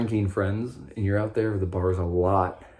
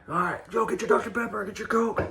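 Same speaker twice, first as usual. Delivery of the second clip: proud friends; 14 semitones higher than usual. Retold or imitated. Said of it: "everybody was like, checking my drink".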